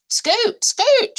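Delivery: high, loud scoot; a rabbit shooing hogs from her garden; shooing pigs away. Acted. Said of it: "she said to Thomas and Joseph".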